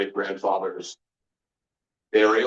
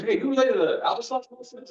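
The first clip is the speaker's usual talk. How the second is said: squeaky, much higher put-on voice asking a naive question; high mock voice. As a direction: pitch way up like a clueless kid. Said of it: "I'm like".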